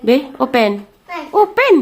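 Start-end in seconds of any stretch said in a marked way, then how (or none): 1.3-1.7 loud high coax. Real, not acted. Feeding baby.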